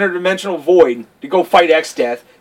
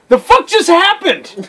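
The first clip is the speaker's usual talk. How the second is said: high, drawn-out mock disbelief; slow, high-pitched bafflement; baffled player; 12 semitones higher, 4 dB louder, slower than usual. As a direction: act out a stunned gamer's outcry. Baffled player, second time.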